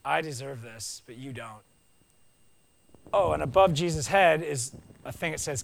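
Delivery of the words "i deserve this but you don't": low, softer admission; humble confession. Retold or imitated. Thief on the cross admitting guilt to Jesus, low and soft. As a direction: confess it quietly, fading away. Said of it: "and he says".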